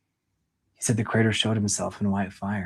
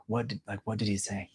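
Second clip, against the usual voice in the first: hushed urgent question; 9 dB quieter than usual. Shown as a demonstration. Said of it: "you'd be like".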